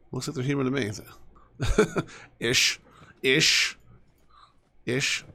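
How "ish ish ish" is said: each "Ish" punched out as its own word; a deliberate, pointed "Ish"; stress on ish. Shown as a demonstration. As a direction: lean on "Ish" every time. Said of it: "Emphasis on the ish".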